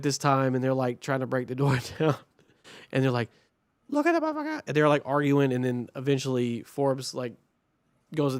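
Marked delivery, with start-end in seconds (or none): 3.9-4.6 high-pitched imitation of shouting cops; mock cop commotion. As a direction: squeal it like cops in an uproar. Imitating cops arguing at the door.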